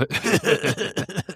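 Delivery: raspy smoker's laugh, high; a wheezy smoker's cackle; hoarse raspy laugh. Real, not acted. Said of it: "it sounded like a smoker's laugh".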